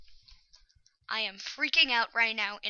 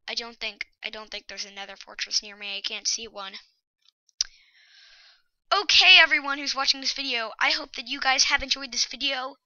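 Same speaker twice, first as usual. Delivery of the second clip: usual speaking voice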